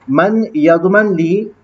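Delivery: मन यज़मन ली drawn out slowly, word by word; slow it down so students catch मन यज़मन ली; slow teaching read. Shown as a demonstration. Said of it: "teacher slowly repeats phrase". (धीमा पाठ)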